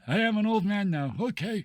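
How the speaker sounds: put-on very old man. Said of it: "That makes perfect sense".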